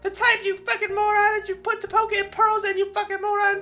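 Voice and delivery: put-on high, bratty girl snapping insults; a tsundere girl scolding an ignorant boy; Natsuki. Natsuki scolding the boy, high and bratty.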